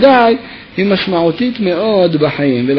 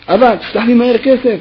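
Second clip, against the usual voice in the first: bossy order, pitched higher; commanding tone; 6 semitones higher, 3 dB louder than usual. Retold or imitated. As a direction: bark it at dad like an order. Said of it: "בלשון הוראות". (בטון פקודה תובעני)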